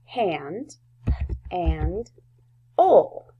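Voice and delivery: "hand and ol" in slow pieces, long pauses between; sounded out by syllables. Shown as a demonstration. Teacher spelling handle for first graders, "hand and ol" sounded out piece by piece. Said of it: "spell it by using the syllables".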